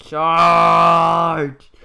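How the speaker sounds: loud, drawn-out battle yell; shouted charge. Real, not acted. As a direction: yell it out long and hard. Calls a charge during gameplay.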